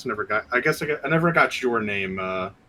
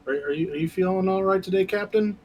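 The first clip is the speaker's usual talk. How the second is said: gingerly concerned; 10 semitones higher than usual. Acted. Player gingerly checks on nervous captain.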